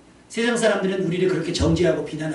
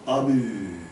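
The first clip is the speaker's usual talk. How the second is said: low, drawn-out drowsy drone; half-asleep amen; 8 semitones lower, much slower than usual. Retold or imitated. Dozing choir member answering the pastor, half-asleep drone. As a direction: mimic someone answering in his sleep. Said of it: "자는 건지 깨는지 모를 정도로".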